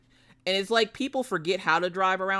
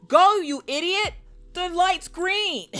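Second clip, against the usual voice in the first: high, loud, slow yell at a driver; mock angry yell; 14 semitones higher, 8 dB louder, slower than usual. Daughter yelling at a slow driver at a green light. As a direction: bellow it at the car ahead. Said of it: "she's like".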